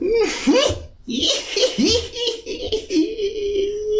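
high shrieking cackle; witch cackle. Acted. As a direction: cackle like a wicked hag. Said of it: "That is my favorite voice".

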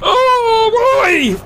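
high cry of alarm; shocked cry. Real, not acted. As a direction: yelp out in shock. Plane mishap cry.

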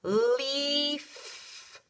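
leaf held long and slow, sounding out; stretched modeling word. Shown as a demonstration. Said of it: "Listen again".

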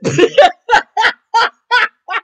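long, high, loud laughing fit; real laughter. Real, not acted. Recapper tickled by the lookalike remark, loud high laughing. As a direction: burst out laughing helplessly. Cracks up at Simpson comparison.

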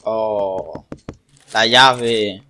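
usual speaking voice

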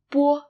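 "bo" with no puff of air on the b; plain unaspirated "bo". Shown as a demonstration. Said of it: "the unaspirated sound".

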